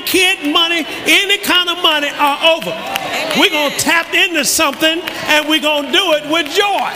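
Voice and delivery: loud, high preaching at full force; rally the congregation with joy; triumphant pulpit proclamation. Acted. Preaching climax about money.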